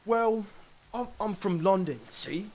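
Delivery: put-on London accent, hesitant; bad British accent. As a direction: try a shaky-footed cockney Londoner. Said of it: "bad British accent".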